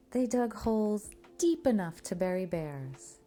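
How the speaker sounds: ordinary delivery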